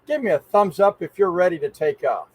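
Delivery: ordinary delivery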